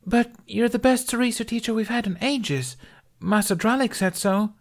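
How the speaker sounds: put-on high young voice, pleading protest; a student begging him to stay; young girl protesting. A narrator's reading. Uli's disappointed protest.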